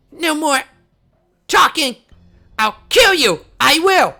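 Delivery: high, loud enraged yell; furious threat. Acted. Cutting off the traitor, a high, loud furious yell. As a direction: scream the threat to drown him out.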